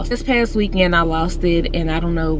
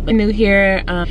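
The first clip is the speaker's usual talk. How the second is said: ordinary talk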